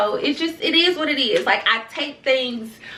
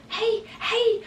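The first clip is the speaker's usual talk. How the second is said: fawning greeting; 13 semitones higher than usual. Retold or imitated. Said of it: "you know".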